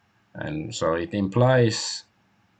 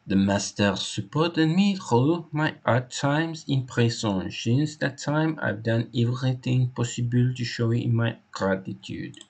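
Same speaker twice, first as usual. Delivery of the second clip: put-on animated French accent, slightly raised; French-accented loyal servant; 4 semitones higher than usual. Hector telling the inspector how he was hired, French-accented. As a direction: play the devoted French employee giving testimony.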